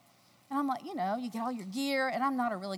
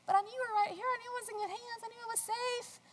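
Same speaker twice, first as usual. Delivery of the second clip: sweet cajoling; 12 semitones higher, 4 dB quieter than usual. Retold or imitated. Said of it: "I'm like".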